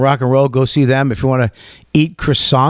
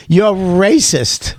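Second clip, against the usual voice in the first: slow accusing; 7 semitones higher, much slower than usual. Retold or imitated. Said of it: "she goes".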